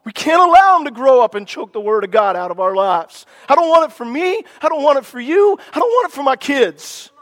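urgent, fiery sermon delivery; preaching at full force. Real, not acted. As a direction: drive each line home like a revival preacher.

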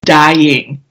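ordinary talk

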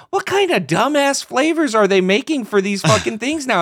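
high, loud incredulous rant; mocking disbelief. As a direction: scoff at the silly flavor. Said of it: "And I'm like".

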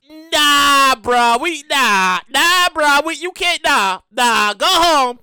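loud, firm brush-off to arriving tourists; mock stern refusal. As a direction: bark go home at the tourists, half joking. Hawaii voiced turning tourists away.

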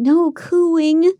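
insist, pushing the pitch up. A narrator's reading.

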